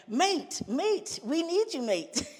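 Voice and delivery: high-pitched, hammed-up British accent; terrible British accent. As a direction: badly imitate a pleading Brit. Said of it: "Terrible accent".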